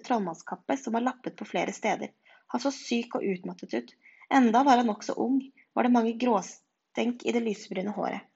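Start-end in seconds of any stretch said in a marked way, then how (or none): none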